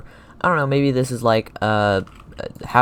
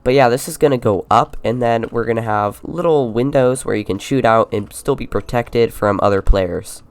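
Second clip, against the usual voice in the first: ordinary delivery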